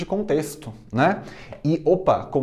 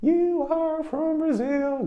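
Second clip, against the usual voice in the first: much higher, slow student-voice echo; high slow drill; 14 semitones higher, much slower than usual. Shown as a demonstration. Teacher drills the line in a high slow voice. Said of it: "Repeat after me".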